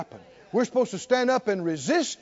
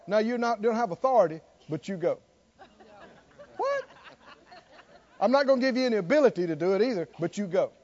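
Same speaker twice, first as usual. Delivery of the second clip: mock commission; faster than usual. Mock version of Jesus's commission.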